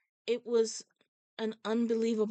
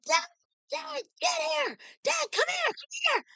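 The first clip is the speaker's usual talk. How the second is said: much higher excited shout; yelling for dad. Retold or imitated. Lady calling her dad over, excited high shout. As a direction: holler for dad across the field. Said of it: "And then she yelled".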